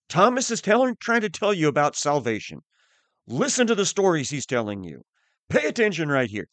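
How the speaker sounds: high, loud and quick, talking over the teacher; loud interrupting voice. Pretend. An imagined disruptive class member talking over the teacher, loud and quick. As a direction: barge in loudly over the lesson. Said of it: "kept saying very loudly, talking over me".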